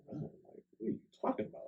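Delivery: what on earth, high and incredulous; baffled question. Retold or imitated. Answering the word run in his heart, high baffled question. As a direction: voice shoots way up in confusion. Reacting to hearing God say run.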